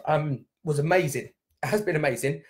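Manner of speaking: ordinary talk, nothing marked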